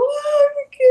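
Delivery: high-pitched imitated sob of fright; a sudden high cry retelling how she burst into tears; retold crying wail. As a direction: replay the tearful squeal of panic. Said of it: "yo me puse a llorar".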